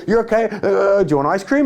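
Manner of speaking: high, hammed-up placating voice; anxious soothing sibling. Older sibling offering ice cream to stop the crying, anxiously soothing. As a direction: coax a child out of tears, high-pitched.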